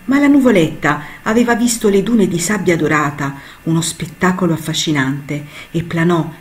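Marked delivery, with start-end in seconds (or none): none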